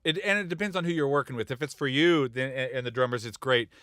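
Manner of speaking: normal talking voice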